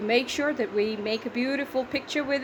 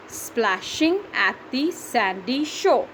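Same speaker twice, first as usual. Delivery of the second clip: slow, separated syllables on "Splashing at the sandy shore"; teacher beating out "Splashing at the sandy shore"; slower than usual. Shown as a demonstration. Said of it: "That's seven".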